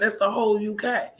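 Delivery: usual speaking voice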